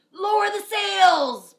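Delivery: high, loud captain shouting over a storm; captain commanding in a storm; shouted order. Acted. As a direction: yell the order across the deck. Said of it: "Braid Beard shouted".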